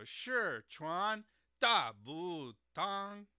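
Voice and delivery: "Sichuan Dabutang" syllable by syllable, slowly, with exaggerated Mandarin tones. Shown as a demonstration. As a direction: say "Sichuan Dabutang" rising, rising, sharply falling, dipping, then high and level. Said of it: "second tone, second tone, fourth tone".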